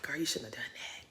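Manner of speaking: whispered, low reproach; barely audible, disappointed aside; hushed disapproval. Real, not acted. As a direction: whisper it like a private scolding. Aside of disapproval to absent friend.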